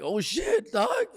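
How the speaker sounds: high, alarmed yelp of dismay; retold panic. Driver reacting as his car slows on the gas, mock panic. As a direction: replay the shock, pitch jumping up at the end.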